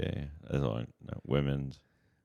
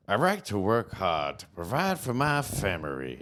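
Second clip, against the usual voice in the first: farmer voice; 4 semitones higher, slower than usual. Shown as a demonstration. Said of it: "What about".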